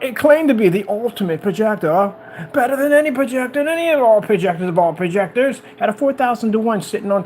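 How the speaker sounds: high-pitched mock sales boast; mock hype. Mimicking boastful projector hype claims.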